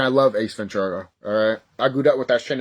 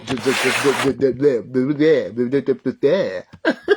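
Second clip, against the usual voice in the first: stuck repeating. Real, not acted. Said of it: "I can't even freaking talk right now".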